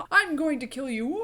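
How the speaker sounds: a laughing, hammed-up villain threat; lightly laughed-through cartoon villain line; mock maniacal villain. Mocking Voldemort's cartoony villain threat, laughing. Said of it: "he's just got a maniacal".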